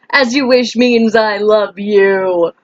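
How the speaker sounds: slow, stretched-out mock declaration; hammed-up quote. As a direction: draw out every word playfully. Hammed-up repeat of the film quote.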